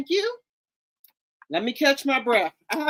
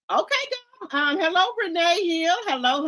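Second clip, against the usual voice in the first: usual speaking voice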